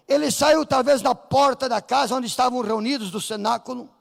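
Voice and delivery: ordinary talk, nothing marked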